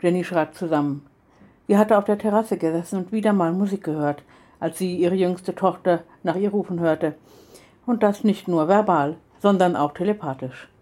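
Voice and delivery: usual speaking voice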